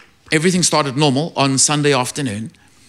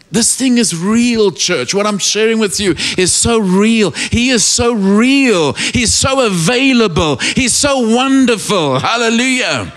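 higher, slow, emphatic preacher voice; fervent preaching; 7 semitones higher, 3 dB louder than usual. Acted. Preacher testifying to the church, fervent conviction. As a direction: press each "so" home with fire.

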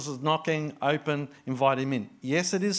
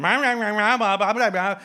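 higher, louder nagging blah blah; louder mocking blah blah; 6 semitones higher, 7 dB louder than usual. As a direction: nag louder in gibberish. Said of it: "it's a bit louder in volume".

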